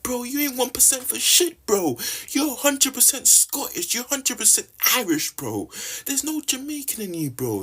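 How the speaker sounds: very high-pitched, incredulous protest; exasperated retort. Acted. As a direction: let the pitch leap up in exasperation. Heated retort to one-percent Jamaican claimers.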